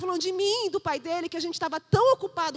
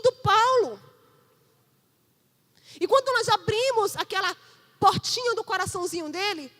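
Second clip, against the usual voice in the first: preaching; 4 semitones higher, 5 dB louder than usual. Acted. Pastor warning the parents about children, intense preaching. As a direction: press the warning home.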